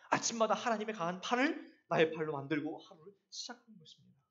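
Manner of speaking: normal talking voice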